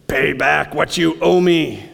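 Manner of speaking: slow, hard demand as the servant. A narrator's reading. Servant choking his fellow servant, slow mild menace. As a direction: press him for the money, slowly. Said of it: "he demanded".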